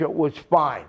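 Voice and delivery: ordinary delivery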